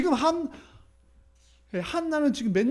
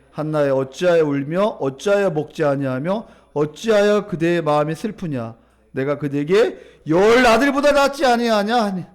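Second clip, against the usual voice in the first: scripture reading aloud; 2 semitones lower, 6 dB louder than usual. Real, not acted. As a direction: lead the congregation through the verse. Leads congregational scripture reading.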